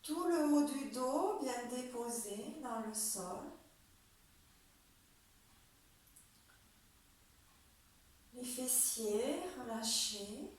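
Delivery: slow, calm yoga nidra guidance with long pauses; guided relaxation. Acted. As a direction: lead them through the body part by part, unhurried. (voix de relaxation guidée, lente et posée)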